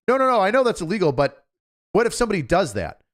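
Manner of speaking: exasperated American. Retold or imitated. American voiced in heated argument.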